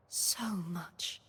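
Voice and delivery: soft, low murmur to herself; quiet, heartfelt afterthought; hushed, wistful echo. Acted. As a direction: let it sink to a near-whisper. Wistful echo of her debt.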